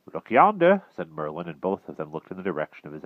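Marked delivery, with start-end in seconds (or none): 0.1-0.8 wizard voice. Acted. Merlin pointing out knight.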